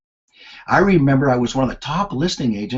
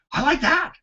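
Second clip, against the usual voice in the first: high, pleased I like that; delighted thought. Retold or imitated. Young accountant dreaming of sales pay, high and delighted. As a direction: savour the idea.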